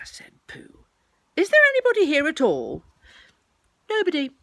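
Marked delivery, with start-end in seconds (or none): 1.3-2.8 loud, high, quick call. Acted. Pooh asking who is in the hole, calling out. 3.9-4.3 loud, high-voiced denial; hidden Rabbit. Rabbit denying anybody is home, high and loud.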